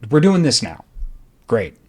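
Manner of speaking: acted-out sarcastic cheer; mock breezy acceptance. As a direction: play a boss suddenly deciding it's all over. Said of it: "were just like".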